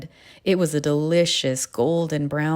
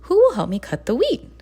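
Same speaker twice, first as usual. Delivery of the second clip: high, louder hopeful question; eager little hen; 8 semitones higher, 5 dB louder than usual. A narrator's reading. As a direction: ask the barnyard brightly for help. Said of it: "she asked".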